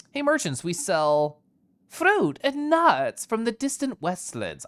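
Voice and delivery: a hint of a travelling merchant; lightly voiced merchant. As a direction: pitch it up like a trader hawking wares. Voices game dialogue choice.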